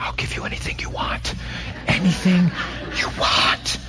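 desperate parent's whisper; under your breath. Acted. Parent bribing child in grocery line, pleading whisper. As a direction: whisper a bribe so no one else hears. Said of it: "under your breath".

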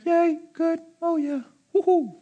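high, feeble woohoo; limp mock cheering. Pastor mocking a passive fan, feeble high cheering. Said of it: "You just don't sit there and".

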